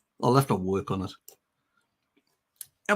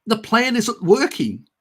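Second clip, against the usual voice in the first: mock whine; 10 semitones higher, 6 dB louder than usual. Voicing disappointed Klaus.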